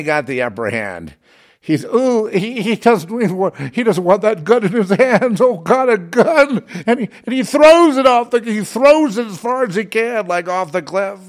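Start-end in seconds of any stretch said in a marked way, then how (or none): 1.6-5.4 high, louder falsetto, disgusted and timid; mocking squeamish hero. Mocking the hero who fears the gun, prissy falsetto. Mocking the hero's fear of guns. 5.4-6.6 very high, slow, loud prissy falsetto gasp; mock horrified hero. Mocking the hero in the film, slow shrill falsetto. Mocking the hero's gun fear. 6.9-11.2 high, louder, still in the prissy voice; lingering mock falsetto. Mocking the hero throwing the gun, high mock voice.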